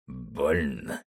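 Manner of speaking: low, sinister taunt; menacing baron. Acted. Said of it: "Барон зловеще ощерился".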